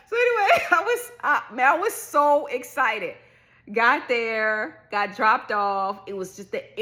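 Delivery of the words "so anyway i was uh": laughing. Real, not acted. Laughing after giddy moment.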